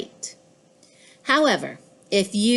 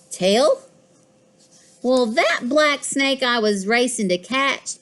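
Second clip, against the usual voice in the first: usual speaking voice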